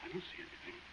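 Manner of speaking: scared whisper in the dark; hushed, nervous whisper. Acted. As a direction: breathe it, barely voiced, afraid to be heard.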